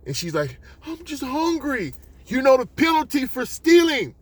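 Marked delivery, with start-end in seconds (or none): 0.8-1.9 put-on high-pitched child's plea; pleading little girl. Little girl pleads with store owner. 2.2-4.2 high, loud angry shout; mad store owner. Acted. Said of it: "You can see he's mad".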